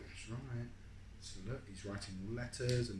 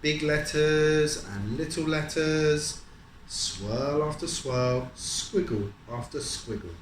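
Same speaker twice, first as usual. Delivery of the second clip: slow, louder sing-song reading; storybook reading; 5 semitones higher, 8 dB louder, much slower than usual. A narrator's reading. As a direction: stretch each phrase for the child. Reading picture book to child.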